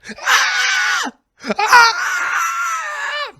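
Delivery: two long, very high screams; horrified screaming. Acted. Comedian reacts to the movie poster on screen, screaming. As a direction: scream your head off at the sight. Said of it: "I'm screaming my head off".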